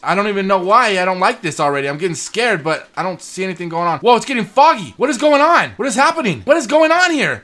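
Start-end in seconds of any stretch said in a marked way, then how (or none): 4.0-7.4 high, alarmed questions; sudden fright at the fog; rising panic; startled. Real, not acted.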